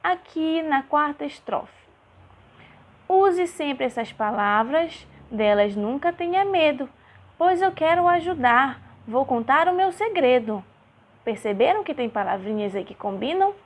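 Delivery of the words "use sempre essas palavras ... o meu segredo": louder, measured verse reading; poem recitation. Acted. Teacher reading a rhyming stanza to children, lively recitation.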